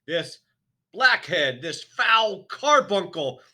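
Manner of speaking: monstrous headmistress in a rage; furious tirade. A narrator's reading. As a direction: spit each insult at him. Trunchbull denounces the boy.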